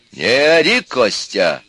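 loud, high opening rebuke; loud telling-off, falling on the name. A narrator's reading. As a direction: raise your voice to silence him. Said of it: "тоже повысил голос отец".

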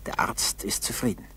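ordinary delivery

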